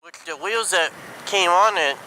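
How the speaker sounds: ordinary talk, nothing marked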